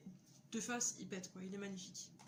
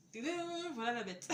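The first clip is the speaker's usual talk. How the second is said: triumphant reveal; 2 semitones higher, 4 dB louder, slower than usual. Real, not acted. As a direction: present it with a playful fanfare. Shows off the long-wanted t-shirt.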